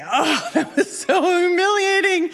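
high, mock-mortified lament; humiliated wail. Diver facing her failed attempt alone, mock humiliated. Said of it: "I could say".

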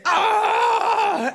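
imitated high, drawn-out cry; mock group "aah", held long and high; crowd's loud cry. Crowd reacting as she hits the water, crying out. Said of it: "what I hear is".

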